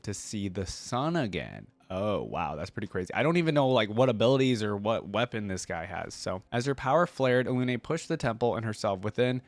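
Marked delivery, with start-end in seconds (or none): none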